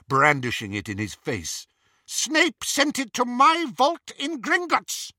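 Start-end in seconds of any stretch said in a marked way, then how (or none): 2.0-5.1 high, slow, outraged insistence; agitated fury. A narrator's reading. Bellatrix to Greyback about the stolen sword, high agitated fury. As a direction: insist on it, pitched right up.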